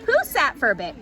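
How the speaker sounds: usual speaking voice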